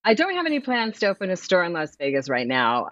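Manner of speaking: usual speaking voice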